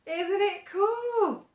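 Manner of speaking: gasp, then high and slow with delight; excited wonder. Acted. Teacher wowing the kids with an expanding ball, high and slow. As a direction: sell the toy with wide-eyed awe.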